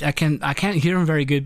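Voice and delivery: ordinary delivery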